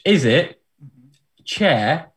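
ordinary talk, nothing marked

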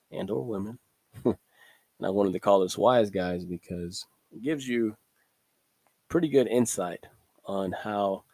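ordinary delivery

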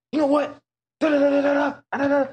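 high-pitched ranting gibberish; retold angry outburst. Retold outburst while lifting chair.

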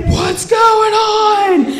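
high, stretched retold yell; half-sung mock scream. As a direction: imitate a screaming nurse, words drawn out. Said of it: "she's screaming like".

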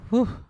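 loud high exhale of relief; relieved whew. Retold or imitated. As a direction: blow out a big mock relieved whew. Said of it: "he's like".

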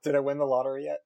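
high, eager joking question; mock-eager question; joking excitement. Real, not acted. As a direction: pretend to beg the future for news. Joking about a guest from the future.

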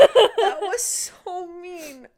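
slow high laughing words. Real, not acted. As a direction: laugh it out slowly. Laughing at sad scene.

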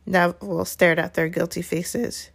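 usual speaking voice

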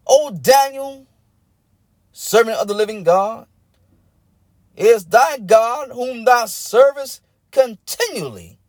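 high, slow calling out to Daniel; the king crying out over the lions' den; lamentable cry. Acted. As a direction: call into the den, aching for an answer. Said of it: "he calls out with a lamentable voice".

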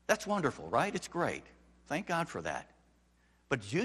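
ordinary delivery

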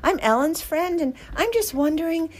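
high, overly polite message voice; sweetly casual. Retold or imitated. Messaging the rescuer, high and sweetly casual. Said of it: "I go".

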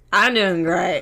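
loud mock drunken boast; drunk imitation. Friend imitates sleepy Grant on the stairs, loud drunken slur. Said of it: "he sounds like he's drunk".

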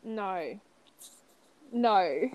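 Australian "No", drawn out; mock Australian. Shown as a demonstration. Asked to make fun of Australian people, mock Australian accent. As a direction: say "No" as a soft send-up of an Australian accent. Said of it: "like you're making fun of Australian people".

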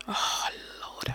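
breathless shocked whisper. Real, not acted. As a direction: gasp it in a whisper.

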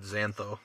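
"xantho" said quietly as ZAN-tho; pronunciation demo. Shown as a demonstration. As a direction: say "xantho" with a Z start and no leading "ig". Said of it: "I would have just said".

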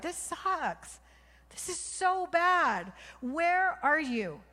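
high, loud, slow desperate cry to God. Retold or imitated. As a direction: cry out to heaven, stretched and pleading. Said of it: "crying out to the Lord".

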